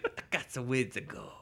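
put-on Queens accent. Imitating the killer's line in a Queens accent. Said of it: "he sounds like he's from Skyrim".

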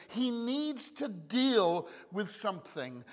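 ordinary talk

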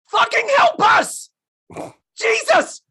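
very high, loud cry for help; desperate yell. Acted. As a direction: holler in exasperated desperation. Acting out what they should shout.

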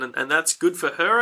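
ordinary talk

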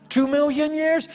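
high, incredulous rising guess; astonished question. Retold or imitated. Pastor guessing the angel's age, astonished. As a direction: raise the guess in amazement. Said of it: "so my pastor said to him".

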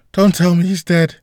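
frantic repeat of the panicked cry. A narrator's reading. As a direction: beg it in desperation. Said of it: "in a panicked voice yelled out".

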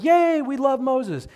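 high, loud, drawn-out crowd cheer; mock cheering. Israelites celebrating their leader Moses, mock cheering. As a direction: cheer like an adoring crowd, hammed up.

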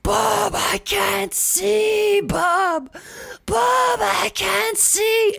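high, drawn-out wail imitating a screaming dying wife; imitation of a guttural, animalistic shriek; imitated raw scream. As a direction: wrench the voice up into a mock scream, holding each word long. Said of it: "wrench my voice, but it's like".

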